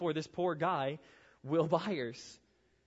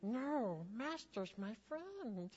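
high, pleading creature voice, slow and softer; higher, softer Smeagol imitation; 6 semitones higher, 8 dB quieter, much slower than usual. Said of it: "And it's like".

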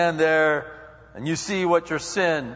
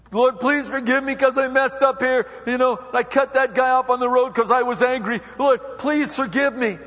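much higher and louder mock plea; pleading sinner; 10 semitones higher, 6 dB louder than usual. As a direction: beg the Lord like a sheepish driver.

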